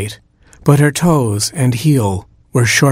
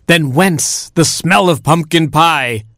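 dramatic cry; 6 semitones higher than usual. Acted. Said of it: "cried the prince".

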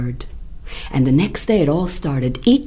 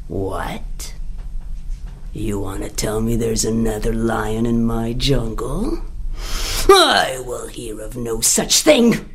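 lower, menacing lion voice; lion's outrage; 6 semitones lower than usual. Acted. Lion demands the rabbit lead him to the rival. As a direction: growl the disbelief, low.

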